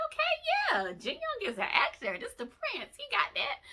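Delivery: high, loud excited gush; mock excited. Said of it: "So I was like".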